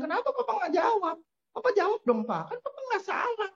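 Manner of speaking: put-on high, pleading little girl complaining to her dad; young daughter's voice. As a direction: sound like a nine-year-old begging Papa to answer back. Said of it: "Anak saya yang, eh, wanita datang kepada saya".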